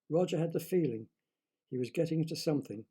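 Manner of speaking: usual speaking voice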